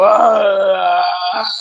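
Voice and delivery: long drawn-out groan of pain; acted-out moan. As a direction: groan it out in one long breath. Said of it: "Every time I groan".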